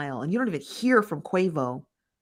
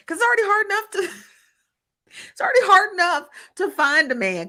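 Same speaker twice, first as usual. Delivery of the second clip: laughing high squeal; 12 semitones higher, 4 dB louder, faster than usual. Pretend. Joking about losing husband.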